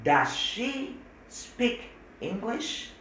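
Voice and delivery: very slow, high English model; very slow demo. Shown as a demonstration. Teacher slowly models the question. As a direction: drag each word out for students. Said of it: "Coba bicara tadi".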